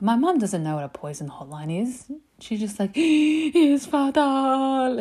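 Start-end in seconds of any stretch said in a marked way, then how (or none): 2.9-5.0 put-on high, loud, very drawn-out accented cry; gasp, then stretch it out like a dying diva; hammed-up imitation of a panicking mother; mum's dramatic wail. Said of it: "And the way she said it".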